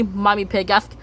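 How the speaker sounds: normal talking voice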